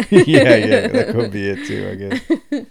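laughing through yes yes. Real, not acted. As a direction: talk through a giggle. Laughing at rifles joke.

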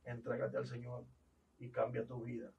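soft, comforting reassurance; calm the man gently; tender comfort to a freed prisoner; reassuring. Retold or imitated.

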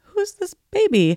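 put-on young, curious kid asking, pitched high and slow; lightly playing her eleven-year-old self; own child self. Girl asking her dream grandmother about the baby, high and wondering. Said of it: "And I'm like".

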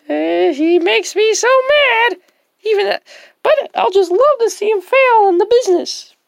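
high, loud grumbling character. Acted.

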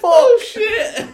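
very high, loud laughing cry; laughing shriek. Real, not acted. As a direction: squeal it laughing. Laughing exclaim.

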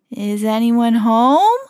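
slow, drawn-out call into the dark house; slow wary call into an empty hall. A narrator's reading. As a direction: call out nervously, stretching it. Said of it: "called Mickey".

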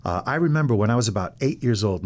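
ordinary talk

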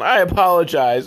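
theatrical "I apologize", loud and lifted; emphatic apology. Real, not acted. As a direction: overdo "I apologize" like a hammed-up sorry. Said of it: "oh, sorry".

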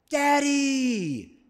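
put-on light child's voice, fond; baby talk. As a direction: say it like a little kid calling dad. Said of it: "this is baby talk for dad".

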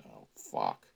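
low muttered curse under the breath; muttered aside. Real, not acted. Reader mutters a curse at the book, low and quiet. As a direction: grumble it off to the side.

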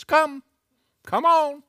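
high, loud beckoning call; Jesus. Acted. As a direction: call someone out across the water. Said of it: "One word back from Jesus".

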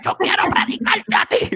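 high-pitched, strained preacher parody; over-the-top comic preaching voice; mock preacher. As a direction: squeeze it out as a hammed-up preacher. Playful mimicry of a preacher.

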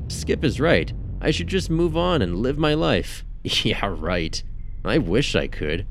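ordinary delivery, nothing marked

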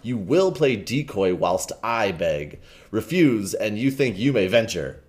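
ordinary delivery

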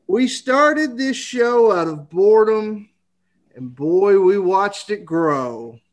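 reading a rhyming Christmas poem; poem recitation. Acted. Rhymed holiday poem opening.

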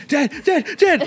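put-on high, urgent child's call; kid calling Dad. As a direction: imitate a kid calling for a parent. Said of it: "me as a kid going".

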